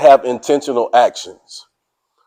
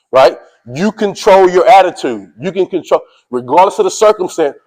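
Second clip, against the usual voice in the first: usual speaking voice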